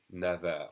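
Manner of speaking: "Never" as French neuf linked with a V; V sound. Shown as a demonstration. Teacher summing up the liaison, V sound in "Never". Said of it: "with a V sound".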